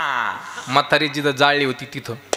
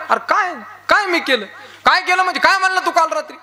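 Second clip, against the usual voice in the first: high, raised furious rebuke; angry scolding; 11 semitones higher than usual. Retold or imitated. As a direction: tear into the joker friend. Enraged mourner scolding friend.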